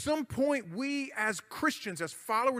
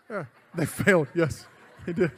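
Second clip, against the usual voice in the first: laughing; 4 semitones lower, 5 dB louder than usual. Real, not acted. Laughs at own joke.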